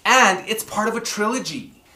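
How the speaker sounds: ordinary talk, nothing marked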